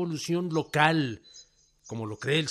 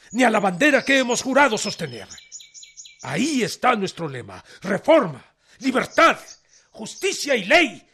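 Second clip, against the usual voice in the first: much higher and louder, fast, shouting the motto; defiant shout; 8 semitones higher, 8 dB louder than usual. Acted. Rebel general to the president's envoy, proclaiming the motto in a defiant shout. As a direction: hurl each word of the motto like a battle cry.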